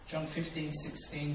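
usual speaking voice

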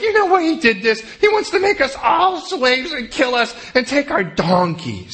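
high, frantic mock panic; high comic panic voice; panicky brothers. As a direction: whip yourself into a silly paranoid frenzy. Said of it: "these guys are like".

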